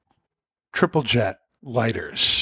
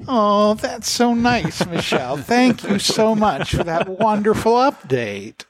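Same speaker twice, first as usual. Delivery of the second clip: playful gushing; 9 semitones higher than usual. Pretend. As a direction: gush as if the absent friend were here. Answers the pretend Michelle gushingly.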